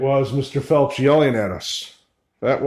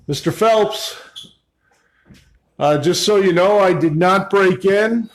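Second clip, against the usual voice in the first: raised, higher voice to someone out of sight; calling out; 6 semitones higher, 3 dB louder than usual. Real, not acted. Visitor telling absent Mister Phelps it's no break-in, calling out. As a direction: call out to explain yourself.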